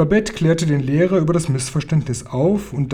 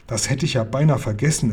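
plain reading voice

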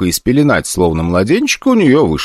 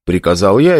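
ordinary delivery

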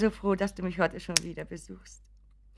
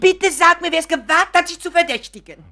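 higher, louder outraged demand; indignant outcry; 10 semitones higher, 13 dB louder than usual. Acted. Mother defending her suspected son, indignant cry. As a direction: demand the name, furious for him.

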